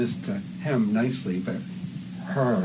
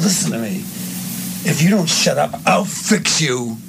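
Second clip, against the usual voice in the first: louder, slow threat; 6 semitones higher, 4 dB louder than usual. Shown as a demonstration. Director demoing the big threat line, louder and slow. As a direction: lean in hard on the threat. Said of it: "that big line".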